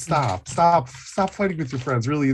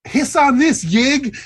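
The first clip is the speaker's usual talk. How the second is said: high, loud taunt; defiant battle cry; 10 semitones higher, 6 dB louder than usual. Acted. Luther throwing the firebomb at Yig, loud defiant taunt. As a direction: hurl it with the bottle.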